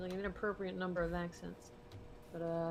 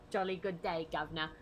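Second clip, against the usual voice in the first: a quieter, lower British accent take; softer British accent; 3 semitones lower than usual. Shown as a demonstration. Trying the British accent line again, more quietly. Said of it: "put a British accent".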